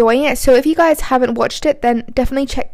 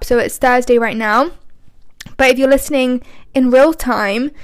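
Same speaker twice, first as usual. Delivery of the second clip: ordinary talk, nothing marked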